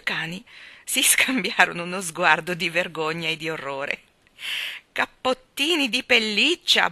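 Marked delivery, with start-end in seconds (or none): 4.9-6.9 slow, louder scornful grumble; grumbling. A narrator's reading. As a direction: grumble with disgust. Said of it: "brontolò l'ammiraglio".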